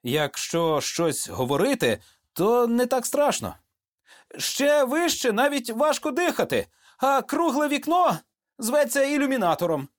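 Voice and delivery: high-pitched chatter against fear; anxious soldier, pitched well up; nervous young soldier. A narrator's reading. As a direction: recite facts high to keep fear down.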